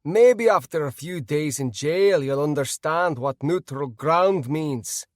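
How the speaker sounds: loud gruff Scottish warning; gruff Scottish accent. Acted. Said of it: "came a gruff voice with a Scottish accent".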